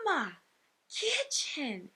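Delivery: lightly imitated excited child; soft, high little girl's call. Little girl shows her mom a play kitchen, mildly excited. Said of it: "And she's so excited".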